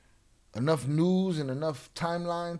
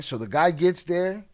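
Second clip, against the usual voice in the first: ordinary talk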